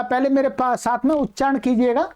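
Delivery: ordinary talk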